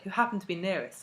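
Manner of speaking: usual speaking voice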